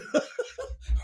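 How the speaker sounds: high unvoiced giggle; breathy laugh. Real, not acted.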